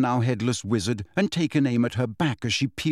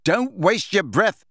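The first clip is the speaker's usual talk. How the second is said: slow, higher-pitched yell; yelled retort; 6 semitones higher than usual. A narrator's reading. Harry to the pleading witch, yelling. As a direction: yell it deliberately, voice raised. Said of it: "yelled Harry".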